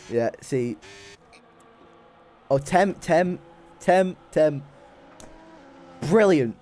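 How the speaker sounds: usual speaking voice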